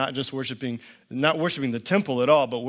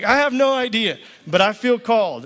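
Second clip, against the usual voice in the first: earnest and fired up; 6 semitones higher, 4 dB louder than usual. Retold or imitated. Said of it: "sitting with a guy in a donut shop".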